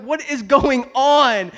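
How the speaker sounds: loud, slow, high exasperated cry; exasperated disbelief. Retold or imitated. Dad retelling his girls licking the seats, loud exasperated disbelief. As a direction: stretch out the bafflement.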